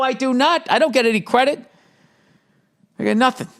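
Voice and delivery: high, exasperated sarcastic rant. Real, not acted. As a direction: whine it in mock outrage. Sarcastic rant about wife's demands.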